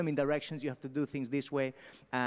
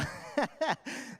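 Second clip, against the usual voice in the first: high real laugh; 10 semitones higher than usual. Real, not acted. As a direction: laugh out at the crowd. Laughing at heckler joke.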